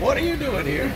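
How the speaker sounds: high, surprised imitation of a buddy bumping into him. Retelling how his buddy greeted him at the airport, high surprised voice. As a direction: squeak it out like a friend amazed to see you. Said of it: "He comes walking up to me and he said".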